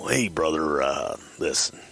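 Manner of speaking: whispery wrestler impression; Randy Savage. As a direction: imitate a wrestler starting a careful explanation. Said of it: "Randy is holding the phone".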